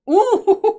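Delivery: high, loud, delighted "ooh"; excited ooh. Real, not acted. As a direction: whoop high at spotting a move. Spots a good move.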